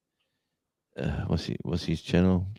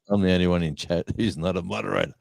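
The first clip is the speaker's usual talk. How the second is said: ordinary delivery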